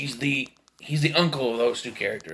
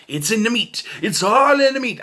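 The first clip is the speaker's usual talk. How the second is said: gleeful loud quote; 7 semitones higher, 6 dB louder than usual. Retold or imitated. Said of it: "He's like".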